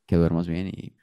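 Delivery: low, softened sign-off with long pauses; voice-message voice. Shown as a demonstration. Man demonstrating a good-night voice message, low and halting. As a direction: let it hang between phrases, pitched low. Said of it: "la gente que hace pausas largas".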